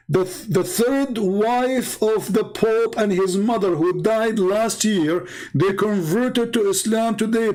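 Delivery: high-pitched, slow, savouring every word of the news; overjoyed slow announcement. Retold or imitated. Chat room admin thrilled to announce the conversion, high and slow. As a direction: announce it slowly like a miracle has happened. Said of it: "The admin who was holding the microphone, he said".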